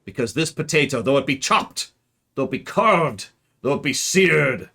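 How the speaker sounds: put-on theatrical suitor in a muddled, not-quite-Irish accent; unplaceable mock accent. King wooing his wife-to-be, hammy muddled accent. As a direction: declaim love grandly in an unidentifiable mock accent. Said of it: "I do not know what this accent is".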